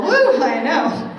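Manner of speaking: slow, high, loud laughing exclaim. Real, not acted. Trainer laughing at the long French term, loud and high.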